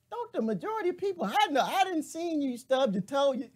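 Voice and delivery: high, fast mock retort through laughter. Host imitating her own reply to critics, high and giggling. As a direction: fire back in a squeaky giggling voice. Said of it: "I'm just sitting here like".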